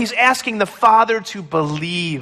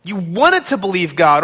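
normal talking voice

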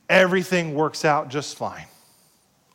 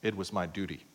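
low, soft, flat-duty reply, much quieter; dutiful husband; 9 semitones lower, 11 dB quieter than usual. Retold or imitated. Husband hands his wife roses, answers without feeling. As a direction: mumble it like a chore you owe. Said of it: "And your husband says this".

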